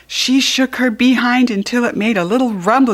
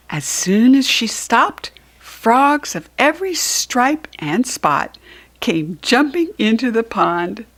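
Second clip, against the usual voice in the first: ordinary delivery, nothing marked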